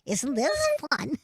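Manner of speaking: kiddie voice. Shown as a demonstration. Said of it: "So I like to talk like this".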